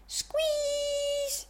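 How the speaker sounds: high, drawn-out soft squeal; long, high play-acted squeeze. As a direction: squeak it out through a big hug. Toys sharing a group hug.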